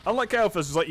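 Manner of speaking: ordinary talk, nothing marked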